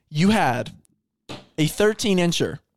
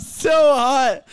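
laughing; 7 semitones higher than usual. Real, not acted. Overheated from laughing.

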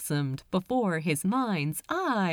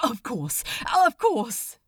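beaming; 3 semitones higher than usual. Acted. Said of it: "beamed Aziraphale".

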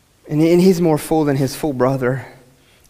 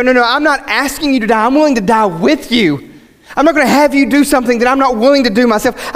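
rallying king; 8 semitones higher, 4 dB louder than usual. Acted. Voices king rallying his soldiers.

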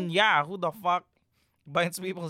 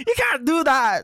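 laughing, lisping boxer voice; a hint of a lisping boxer; Mike Tyson impression; 10 semitones higher, 8 dB louder than usual. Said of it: "Mike Tyson".

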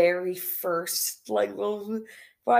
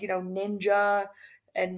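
normal talking voice

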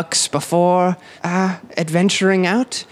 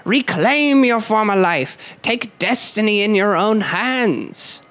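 high, slow street-preacher cry; rising impassioned oratory; 6 semitones higher, slower than usual. Acted. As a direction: preach it to the crowd. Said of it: "voice of an unwashed preacher pierces".

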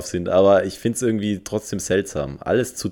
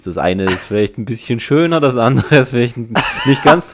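rising, louder teasing judgement; playfully rate the ugly baby; mock appraising; 5 semitones higher, 7 dB louder than usual. Mock judging babies' looks.

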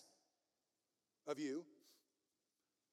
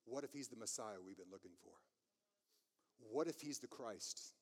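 inward questioning; 8 semitones lower, 10 dB quieter than usual. Retold or imitated. Pharisee asking himself whether Jesus is Christ, inward questioning. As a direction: voice a secret doubt, soft and low. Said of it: "I think internally he was asking".

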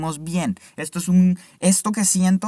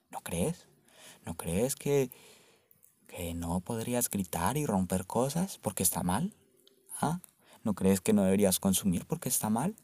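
low, soft voice with questions lifting at the end; mock insinuating questioner; 8 semitones lower, 6 dB quieter than usual. Pressing listeners on whether caring is bad, mock insinuating questioner. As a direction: quietly probe the listeners, low and leading.

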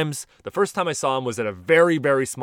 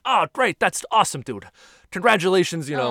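higher-pitched, friendly comedian congratulating a fan; Bill Burr; 6 semitones higher than usual. Retold or imitated. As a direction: imitate an idol's upbeat thanks, pitched up. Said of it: "And he's like".

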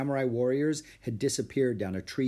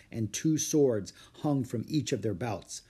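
ordinary delivery

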